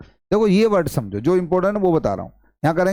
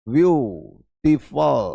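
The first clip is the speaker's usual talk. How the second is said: slow drawn-out word; 2 semitones lower, much slower than usual. Shown as a demonstration.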